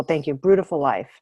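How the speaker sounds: usual speaking voice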